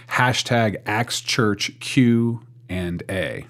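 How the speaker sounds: very slow, spaced-out hashtag announcement; slow dictation. Real, not acted.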